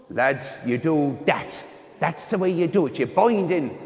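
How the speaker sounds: slow, put-on voice of an instructing sister; mock nun's rugby instructions; nun coaching rugby. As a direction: imitate a nun teaching lads the scrum. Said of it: "sister took the rugby lesson".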